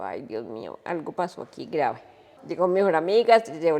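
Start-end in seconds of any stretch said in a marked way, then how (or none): 0.0-2.0 retold dismay. Recalling alarm at hospital news.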